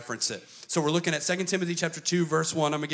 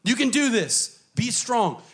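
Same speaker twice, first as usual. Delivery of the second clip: higher, louder hyped coach; coach pep talk; 9 semitones higher, 6 dB louder than usual. Retold or imitated. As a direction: fire him up like a coach. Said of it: "Paul is a coach".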